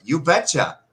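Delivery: playful Fargo-style you betcha; mock Minnesota accent. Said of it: "they're like".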